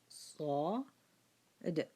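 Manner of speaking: صاد stretched very slowly and softly; drawn-out letter. Shown as a demonstration. Teacher slowly showing the letter صاد. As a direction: draw صاد out long. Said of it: "This is a qalqala".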